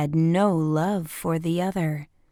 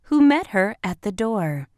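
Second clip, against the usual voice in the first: usual speaking voice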